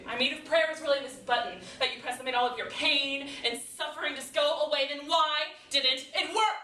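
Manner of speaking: building from pointed to high, loud outrage; escalating challenge to God; indignant questioning. Acted. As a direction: argue with mounting frustration. Monologue, building rage at unanswered prayer.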